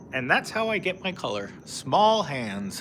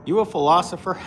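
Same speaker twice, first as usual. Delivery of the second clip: ordinary delivery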